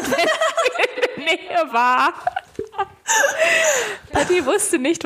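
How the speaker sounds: laughing while reading. Real, not acted. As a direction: read it through laughter. Reading story, cracks up.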